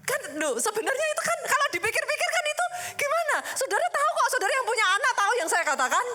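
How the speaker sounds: rising amused urgency toward the crowd; excited appeal. Real, not acted. Preacher excitedly appealing to parents in the congregation.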